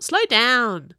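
loud, high call to slow down; a brother calling after his twin. A narrator's reading. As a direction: shout it after him. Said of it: "Cyrus called out".

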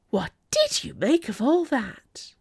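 excited. A narrator's reading. Delphine asking her friend about the spectacle, excited. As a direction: savour the question with gossipy delight. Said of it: "her eyes shining".

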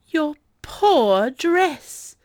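high, loud, very drawn-out commiseration; gushing sympathy. A narrator's reading. Delphine hugging her friend over the dress, commiserating. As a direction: wail it slowly in sympathy. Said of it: "giving her friend a hug".

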